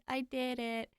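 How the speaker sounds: usual speaking voice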